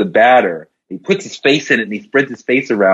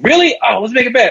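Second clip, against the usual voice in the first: high, eager bartender voice; a keen barman smelling easy money; 12 semitones higher, faster than usual. Acted. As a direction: perk up and challenge him to a bet.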